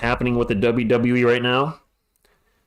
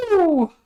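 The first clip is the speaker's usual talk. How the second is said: wrestling woo. Acted. Wrestling whoop after call.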